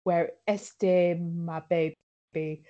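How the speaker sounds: put-on slow, soft Spanish-accented plea; a faint Spanish mother asking; weak Spanish accent. Weak Conchita asking for her baby, soft Spanish accent. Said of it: "she's like".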